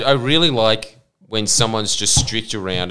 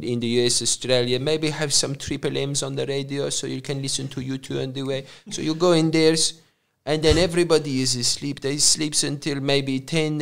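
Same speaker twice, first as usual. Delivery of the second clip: put-on soft-spoken Croatian-accented English; Croatian dad impression; 3 dB quieter than usual. Retelling a dad's working life under Tito, Croatian accent. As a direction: imitate a dad telling his story in a Croatian accent. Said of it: "your dad describing".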